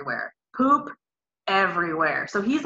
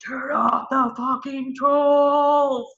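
slow, louder angry snap; furious demand; 6 semitones higher, 4 dB louder, much slower than usual. Retold or imitated. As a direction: bark the order from the labor tub. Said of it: "And I'm like".

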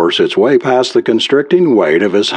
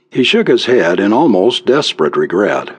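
plain reading voice

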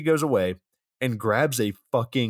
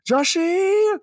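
very high mock wail; exaggerated high, loud tearful call; high-pitched crying; 5 dB louder, much slower than usual. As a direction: cry out the name in a hammed-up falsetto. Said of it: "crying this high-pitched".

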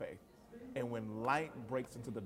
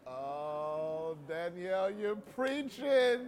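real excited cheering; 5 semitones higher, much slower than usual. Real, not acted. Co-host egging on the storyteller, rising drawn-out delight. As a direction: cheer the preacher on, stretching the words.

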